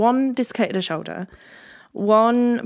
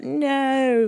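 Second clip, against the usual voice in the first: higher-pitched retold "no"; panicked mock cry; 7 semitones higher than usual. Skier snowploughing down the hill, in mock panic. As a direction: cry it out while sliding helplessly. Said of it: "be like".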